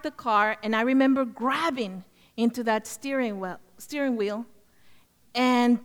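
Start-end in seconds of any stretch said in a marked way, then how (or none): none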